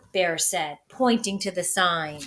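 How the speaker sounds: ordinary delivery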